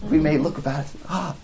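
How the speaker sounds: sarcastic mock awe. Mocking idols as wonder-workers, in sarcastic amazement. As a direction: act wowed by a useless god. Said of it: "This is this holy sarcasm".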